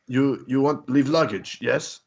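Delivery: amateur mock Russian accent; imitated Russian accent. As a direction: imitate a Russian host with little English. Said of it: "Very thick Russian accent".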